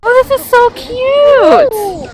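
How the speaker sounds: high, drawn-out delighted cooing; delighted gushing. Real, not acted. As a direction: gush slowly, way up high, over something adorable. Gushing over something cute.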